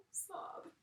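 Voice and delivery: breathy fake cry on the word; mock crying sob; pretend sob. Shown as a demonstration. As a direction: act out a sob for the class. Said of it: "Sob means to cry really hard".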